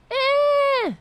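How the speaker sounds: loud, very high wordless screech; mock yelling. Host mocks a politician always yelling at people, screeched mock yell. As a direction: mimic her with one piercing held yell. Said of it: "Why are you always yelling".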